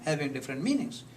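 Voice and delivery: ordinary talk, nothing marked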